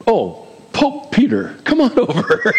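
playful mock greeting, laughing; joking, breaking into laughter. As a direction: crack up while calling someone over. Joking summons to Peter as Pope.

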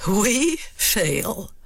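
dry mocking sneer, rising at the end; mock his worry with scorn; sarcastic. Shown as a demonstration. Lady Macbeth sarcastically mocking Macbeth's doubt.

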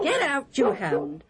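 high yell, falling at the end; scolding shout. Acted. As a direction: shoo off a dog sharply. Esau yells at the dog.

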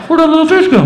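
high, loud, puzzled whine; laughing question about the vanished fish; baffled high squeal. Retold or imitated. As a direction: sound bewildered and a little scared. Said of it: "I'm like".

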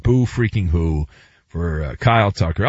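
Boo freaking who dropped low; low dismissal. Acted. Second take, low, dismissive repeat.

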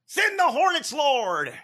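high preachy mock prayer; mock preacher cry. Mocking a TV preacher's prayer for hornets, high. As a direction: ham up the televangelist's plea.

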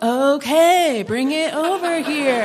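mock an oblivious girl happily agreeing; oblivious cheerfulness. Annie missing the boy's innuendo, obliviously cheerful.

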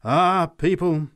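slow, high, loud denunciation; fervent preaching. A narrator's reading. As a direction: draw it out to the crowd. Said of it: "she continued".

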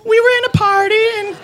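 put-on very high girly excuse-making voice; Virgin Mary voice. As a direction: squeak it out like a tipsy girl explaining herself. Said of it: "she's like".

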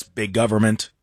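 a machine's single tone; robotic monotone. Shown as a demonstration. Host repeating the robot voice he hears, low robot monotone. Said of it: "in this monotone, robotic sounding voice".

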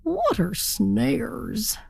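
very slow, puzzled turkey voice; wondering to himself. A narrator's reading. Turkey wonders about the warning, slow puzzlement. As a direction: draw each word out in wonder. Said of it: "he wondered to himself".

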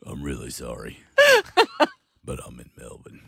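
hammed-up deep tradie sob; low, quiet mock-crying tradie. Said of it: "Like as if your voice isn't deep".

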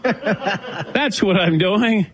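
real laugh through the words, very slow. Real, not acted. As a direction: laugh while you say it. Laughing at own fainting story.

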